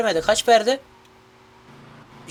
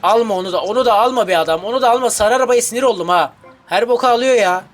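louder, exasperated pleading; annoyed yelling; 3 semitones higher, 5 dB louder, faster than usual. Real, not acted. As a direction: shout at the yellow car to stop. Yelling at yellow car. (sarı arabaya sinirli bağırış)

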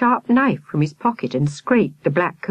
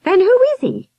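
put-on high, loud demanding girl; demanding question; 6 dB louder than usual. A narrator's reading. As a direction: insist on an answer. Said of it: "Victoria demanded".

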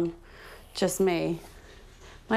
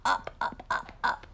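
whispering; 6 dB quieter than usual. Real, not acted. Whispering during stretching exercises.

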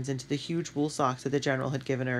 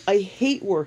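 pained outburst, louder and higher; cried out; 6 semitones higher, 6 dB louder than usual. A narrator's reading. Cried out in pain, louder. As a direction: Hooter cries out in pain over war. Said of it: "Hooter cried".

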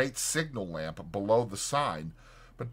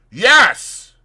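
loud, high, decisive burst; sudden decision; 11 semitones higher, 15 dB louder than usual. A narrator's reading. Fetz resolves to confront the doctor, suddenly decisive. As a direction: declare it loudly as a sudden resolve. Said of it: "with a sudden decision".

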